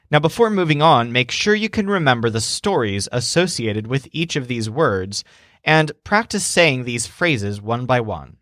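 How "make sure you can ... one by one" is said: normal talking voice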